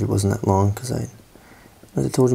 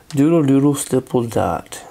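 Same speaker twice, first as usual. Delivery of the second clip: doodle poetry. Pretend. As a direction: recite a made-up poem lightly. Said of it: "It's doodle poetry".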